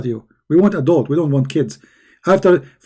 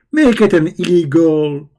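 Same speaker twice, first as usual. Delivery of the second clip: ordinary talk, nothing marked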